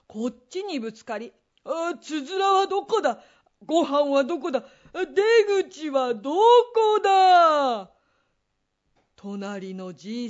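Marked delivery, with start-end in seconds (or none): 1.6-7.9 growing frantic shout; panicked yell. Acted. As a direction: call out louder and louder. Panics in dark.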